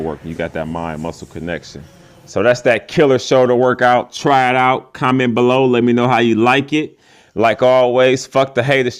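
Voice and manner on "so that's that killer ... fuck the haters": loud, pumped outro to the viewers; hyped sign-off. Real, not acted. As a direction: wrap it up big and loud like a hype man.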